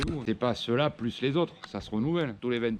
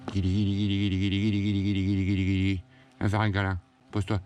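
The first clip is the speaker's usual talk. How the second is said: cooing; 5 semitones lower than usual. Real, not acted. Cooing to calm hornets.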